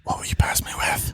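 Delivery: unvoiced flirty whisper. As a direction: breathe it as a secret. Flirty whispered role play.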